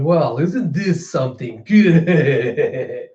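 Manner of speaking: slow, drawn-out scheming purr; sly villain. Retold or imitated. Orochi gloating slowly at the gathered crowd. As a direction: savour it like a gloating tyrant. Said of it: "you see Orochi going".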